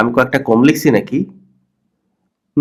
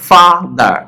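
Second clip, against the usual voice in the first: Father broken into two parts, ফা then দার; split into syllables; 3 semitones higher, much slower than usual. Shown as a demonstration. Teacher showing learners the parts of a word, syllable by syllable. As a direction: say father slowly, one syllable at a time. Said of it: "ছোট ছোট ভাগে ভাগ করছো".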